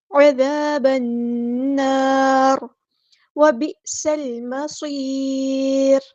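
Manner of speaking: slow, drawn-out chanted recitation; tajweed chant; Quranic recitation. Shown as a demonstration. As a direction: recite it melodically, stretching each sound. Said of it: "recites Quran verse in tajweed".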